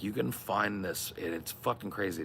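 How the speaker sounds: ordinary talk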